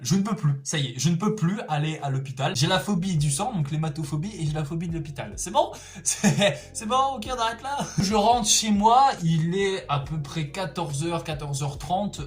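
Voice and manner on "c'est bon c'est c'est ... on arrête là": giggling through the words; laughing. Real, not acted. Laughing at own phobia.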